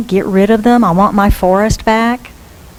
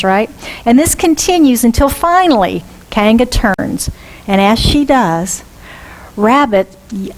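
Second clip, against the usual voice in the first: ordinary talk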